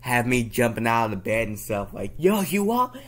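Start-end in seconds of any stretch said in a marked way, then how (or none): 2.2-3.0 sudden high, loud call. Retold or imitated. Startling someone out of bed, calling out. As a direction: imitate a loud wake-up holler.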